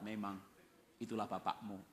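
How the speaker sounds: knowing disapproval. Acted. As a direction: drop low and confirm it quietly.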